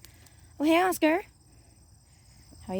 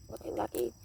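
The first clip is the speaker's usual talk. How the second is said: whisper; 13 semitones lower, 9 dB quieter, faster than usual. Pretend. Calling the cat softly.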